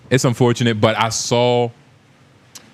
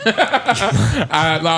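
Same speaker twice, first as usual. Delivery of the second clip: ordinary talk, nothing marked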